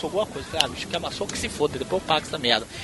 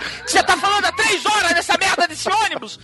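angry shout; 10 semitones higher, 4 dB louder than usual. Retold or imitated. Yelling at a woman on bus.

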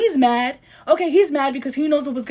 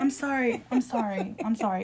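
real, softer repeated apology; sheepish apology; 2 semitones lower, 7 dB quieter than usual. Real, not acted. Host apologizing to co-host for shouting, sheepish. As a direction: drop the volume and back off.

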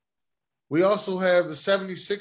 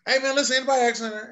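mock protest; 8 semitones higher, 3 dB louder, much faster than usual. Real, not acted. Protesting a sweep prediction.

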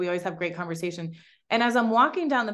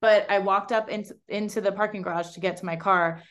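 ordinary talk

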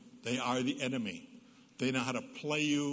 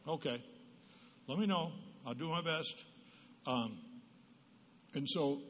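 ordinary talk, nothing marked

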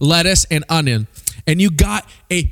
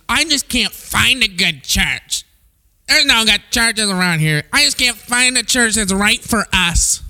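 mope about finding no church; whiny churchgoer; 3 semitones higher than usual. Retold or imitated. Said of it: "what I'll hear oftentimes is".

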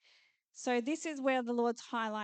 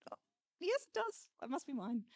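hopeful believer; 3 semitones higher, 3 dB quieter than usual. Retold or imitated. Believer persuading himself of the promise, lightly voiced eagerness. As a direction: talk yourself into it, lightly voiced. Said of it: "voicing a hopeful believer".

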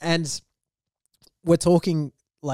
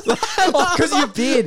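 high, laughing quip; 11 semitones higher, 5 dB louder, much slower than usual. Real, not acted. Teasing his friend about the beard, laughing. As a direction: squeeze the words out through laughter.

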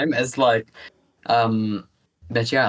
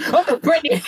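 high laughing gasp of surprise; mock startled cry. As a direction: act caught off guard, laughing. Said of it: "turning the corner and going".

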